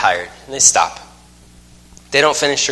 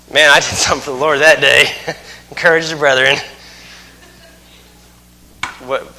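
ordinary talk

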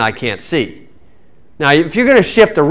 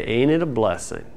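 sweet smiling; 4 semitones lower, 9 dB quieter than usual. Retold or imitated. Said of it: "is when you smile".

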